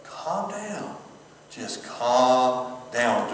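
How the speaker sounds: soft, slow self-soothing; hushed calm-down, drawn out; calming yourself. Shown as a demonstration. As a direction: talk yourself down gently. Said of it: "Do your breathing exercises".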